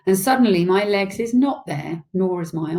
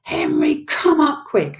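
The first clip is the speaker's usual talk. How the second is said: high-pitched mother calling out; mummy's alarmed yell; urgent yell; 10 semitones higher, 3 dB louder, slower than usual. A narrator's reading. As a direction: call urgently for help, voice up high. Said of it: "she yells".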